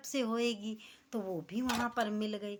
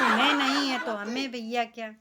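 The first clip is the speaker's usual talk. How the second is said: laughing; 6 dB louder than usual. Real, not acted. Laughing aside about her own bahu.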